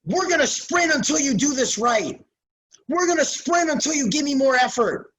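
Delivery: hammed-up angry coach yelling at players. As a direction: bark the ultimatum like an old-school coach. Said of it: "Instead of yelling at a kid".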